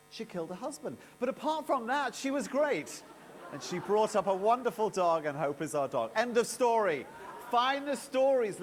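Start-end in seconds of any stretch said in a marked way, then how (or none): 1.2-3.0 much higher and louder, ironic cheer; ironic punchline. Real, not acted. Deadpan punchline after killer reveal. 6.1-8.6 louder and higher, a rousing close; big finish. Real, not acted. Closing keynote flourish.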